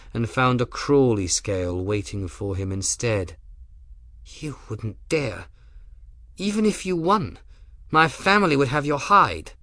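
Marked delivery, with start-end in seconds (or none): 4.2-9.5 shaken but still haughty; Rook's voice. Acted. Rook shaken.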